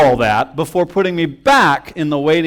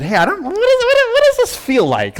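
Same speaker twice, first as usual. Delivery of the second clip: much higher, anxious squeaky voice; panicked question. Retold or imitated. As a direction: ask in a rising panic, voice jumping up. Panicked text to brother.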